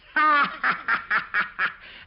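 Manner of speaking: loud, high burst of laughter; real laugh. Real, not acted. Recalling Zanuck's censorship to the interviewer, a loud real laugh. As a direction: break into a big laugh at the memory.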